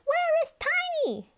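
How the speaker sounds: very high, loud call; crying out. A narrator's reading. Big Bob rushes in looking for Tiny, crying out loud. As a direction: cry out anxiously for the dog. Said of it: "cried Big Bob".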